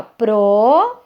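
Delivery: "pro" held out on its own; single out "pro" as one syllable, then pause; drawn-out, emphatic "pro"; isolated syllable. Shown as a demonstration. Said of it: "é uma sílaba".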